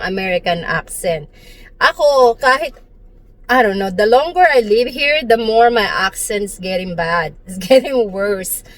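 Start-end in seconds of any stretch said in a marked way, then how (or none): none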